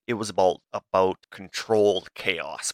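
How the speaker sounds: ordinary talk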